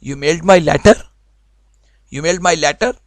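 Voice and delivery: loud, rising tune turns "You mailed my letter?" into a question; loud, doubtful asking tone; questioning rise. Shown as a demonstration. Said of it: "वो पूछने की बजाय मैं आपसे कहता हूँ".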